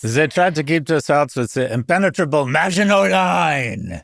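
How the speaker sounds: a mock French accent, loud and high; cartoon French accent. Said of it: "Was that your French accent?".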